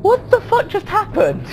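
high-pitched stunned squeak; bewildered. Real, not acted. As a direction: squeal it in disbelief. Startled by game event.

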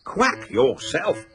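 slow, indignant retort. Acted. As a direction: draw it out, offended. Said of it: "said Duck indignantly".

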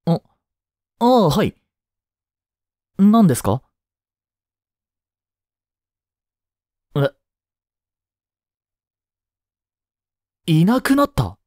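a sudden start at unexpected news; startled. Acted. Teacher hears a student went missing, startled. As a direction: caught off guard, pitch jumping up.

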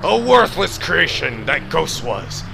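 villain rising to a shout; ranting villain. Acted. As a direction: build from sneer to yell.

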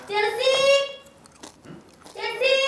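high-pitched call for someone. Acted. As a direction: call a housemate's name in a high, rising voice. Calling out for a housemate. (memanggil dengan nada tinggi)

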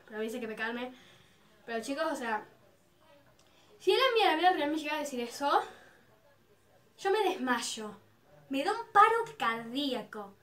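ordinary talk, nothing marked

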